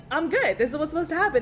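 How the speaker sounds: imitating an unfazed patient, voice up; acted reassured patient. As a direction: act out a patient insisting all is fine. Acting out a patient who was forewarned.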